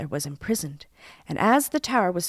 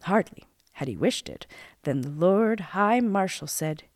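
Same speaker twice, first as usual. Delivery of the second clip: plain reading voice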